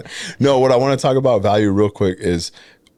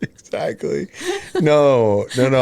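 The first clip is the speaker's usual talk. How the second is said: laughing; 7 semitones higher, 3 dB louder, slower than usual. Real, not acted. Laughing agreement with wife.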